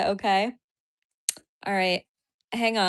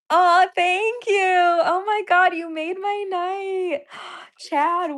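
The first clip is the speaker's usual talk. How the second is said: high, slow, overwhelmed gushing; squealing thanks to a generous donor; 10 semitones higher, slower than usual. Real, not acted. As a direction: melt with gratitude, drawing each word out.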